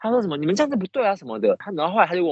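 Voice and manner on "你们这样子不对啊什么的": imitating the customer's furious scolding, pitched up; mock angry yelling. Customer berating the shop staff on the phone, mock angry rant. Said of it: "然后他就开始疯狂大骂".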